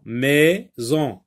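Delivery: "maison" split into two beats, mai, then zon; syllable by syllable. Shown as a demonstration. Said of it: "le sectionner en syllabes".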